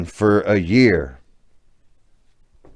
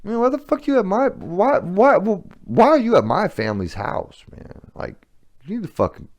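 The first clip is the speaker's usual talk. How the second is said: high, fast mock-angry outburst at the ex-wife; pissed-off rant; 11 semitones higher than usual. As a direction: replay your selfish anger, pitched way up. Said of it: "getting pissed off".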